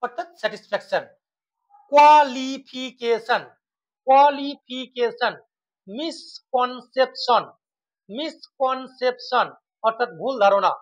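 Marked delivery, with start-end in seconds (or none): 1.9-5.3 কোয়ালিফিকেশন in separated beats; broken into syllables. Shown as a demonstration. Teacher demonstrating polysyllables, কোয়ালিফিকেশন divided up. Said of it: "তিনটির অধিক syllable থাকে". 5.9-9.5 মিসকনসেপশন in four slow beats; broken into syllables. Shown as a demonstration. Teacher demonstrating polysyllables, মিসকনসেপশন split in four. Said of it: "তিনটির অধিক syllable থাকে".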